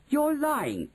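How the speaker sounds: high-pitched accusing cry; outraged boy's high protest; indignant cry. A narrator's reading. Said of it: "he cried".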